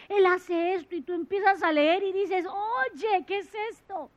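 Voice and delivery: preaching loud to the congregation about the Word; fervent preaching. Acted. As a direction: preach it out, full of wonder. Preaching with rising fervour.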